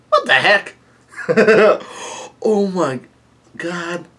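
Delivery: high-pitched disbelief breaking into laughter; real astonishment. Real, not acted. Reader reacts to a huge bounty, real laughing astonishment.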